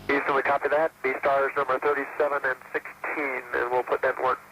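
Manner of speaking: radio from orbit; astronaut radio. Real, not acted. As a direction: crew voice over space radio. Astronaut replies over radio.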